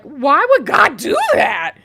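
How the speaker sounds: a mock listener's loud, drawn-out outcry; incredulous shout. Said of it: "you might be like".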